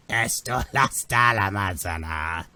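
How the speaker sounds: slow shouted alien speech; put-on alien creature voice; alien raider. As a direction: call it out slowly as an alien raider. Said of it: "they're like".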